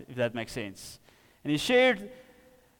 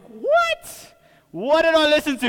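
shocked; 8 semitones higher, 7 dB louder than usual. Retold or imitated. Shocked at old song lyrics.

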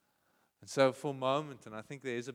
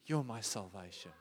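lower, softer, tender; hushed reply; 3 semitones lower, 5 dB quieter than usual. Acted. The soul asking softly to hear God's promise. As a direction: let it fall soft and low.

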